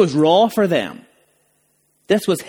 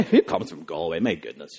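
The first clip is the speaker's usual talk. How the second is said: mock posh snob, low and much softer; snobbish sneer; 12 semitones lower, 7 dB quieter than usual. Imitating a posh city snob dismissing Galway, lightly sniffy. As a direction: look down your nose at Galway. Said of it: "that sort of mentality".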